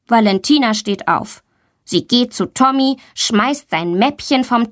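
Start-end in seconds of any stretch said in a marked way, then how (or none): none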